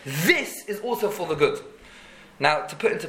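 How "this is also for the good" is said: "This" stretched out and pushed; stress on THIS. Shown as a demonstration. Teacher translating Gam Zu L'Tova, emphasis on "This". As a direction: lean hard on "This", then let the rest follow. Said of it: "the emphasis on the word Zu".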